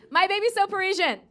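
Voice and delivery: high, loud call to the crowd; call-and-response shout. Acted. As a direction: throw the line out for an answer.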